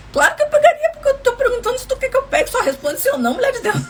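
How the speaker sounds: high, laughing mock outburst; laughing exasperation. Husband's view of his wife, told through high laughter. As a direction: squeal it out, cracking up at the husband's logic.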